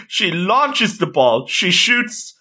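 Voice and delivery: slow, rising play-by-play call; drawn-out mock match commentary; sports commentary. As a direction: build the shot like a sportscaster. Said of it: "we're having the commentary action".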